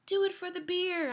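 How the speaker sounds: high, loud cheer from the roadside; mock cheering shout. Spectator lady cheers runners on with a loud high shout. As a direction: yell it like a race spectator. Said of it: "So this lady says".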